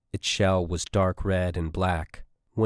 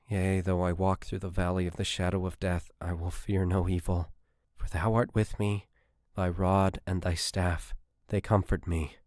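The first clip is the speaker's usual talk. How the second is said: recited prayer; 4 dB quieter than usual. Acted. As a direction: murmur the psalm to steady yourself. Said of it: "Miranda recited".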